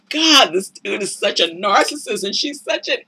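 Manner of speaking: disbelief building to a jab; exasperated. Retold or imitated. Reacting to the TV couple, rising exasperated jab. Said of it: "And I was just like".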